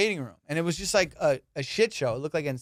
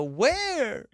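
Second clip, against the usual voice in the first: high-pitched imitation of baffled questioning; bewildered; 10 semitones higher than usual. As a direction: ask it high, mock-confused. Imitates confused girlfriend's repeated questions.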